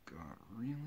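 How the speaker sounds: ordinary talk, nothing marked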